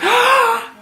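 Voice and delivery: loud, high mock gasp; big acted intake of shock. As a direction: imitate a supervisor's scandalized gasp. Supervisor's shocked reaction, acted.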